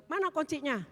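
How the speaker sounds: high, loud retold demand; angry demand. Driver wants the woman's car key, demanding angrily.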